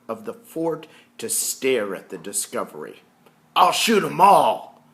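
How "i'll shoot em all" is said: loud, high angry threat; furious soldier vowing to shoot; soldier's threat. Acted. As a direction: snarl it like a soldier ready to fire. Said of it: "one of the soldiers declares".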